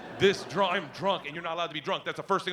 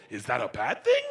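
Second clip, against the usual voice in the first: lower, sarcastic laughing echo; mocking echo; 6 semitones lower than usual. Dad echoing daughter in disbelief, sarcastic. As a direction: mimic her question scornfully.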